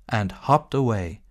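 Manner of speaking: ordinary talk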